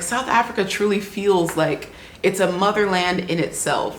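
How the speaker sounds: normal talking voice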